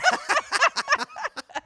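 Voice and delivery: big squealing burst of laughter; loud high laugh. Real, not acted. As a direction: cackle out loud, high and bright. Laughs after his outburst.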